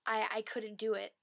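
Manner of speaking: ordinary talk, nothing marked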